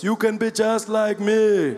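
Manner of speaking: slow, drawn-out politician sales pitch; Julius Malema. Retold or imitated. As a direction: sell it like a speechmaking party leader. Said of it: "There he is selling D V Ds".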